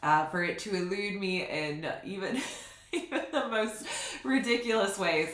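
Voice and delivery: giggling through the words; laughing. Real, not acted. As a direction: laugh while you say it. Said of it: "I laugh at my desire".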